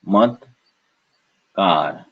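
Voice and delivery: "mat, kar" as isolated syllables. Shown as a demonstration. Teacher splitting a word, "mat, kar" in separate beats. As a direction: pause between "mat" and "kar". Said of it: "So we have cha".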